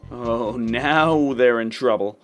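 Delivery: normal talking voice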